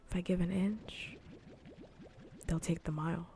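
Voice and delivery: ordinary talk, nothing marked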